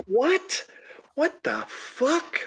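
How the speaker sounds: incredulous disbelief. Real, not acted. Reacting to absurd egg line.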